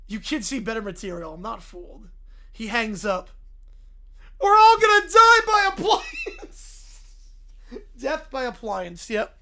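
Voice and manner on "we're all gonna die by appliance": doom-laden wail. Acted.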